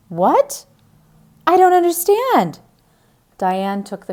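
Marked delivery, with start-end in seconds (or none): 0.1-2.6 high, loud disbelief; shocked confusion. Acted. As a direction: she can't make sense of it. Diane shocked by missing bottle.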